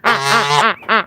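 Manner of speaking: ordinary talk, nothing marked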